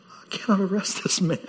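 hushed, whispered confession; awed whisper. Acted. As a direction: whisper it like you're stunned. Officers awed after hearing Jesus.

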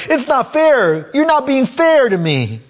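put-on high, slow whining like a crying child; mock a child's whine that it's not fair; whiny child. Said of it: "he's like a child that's crying".

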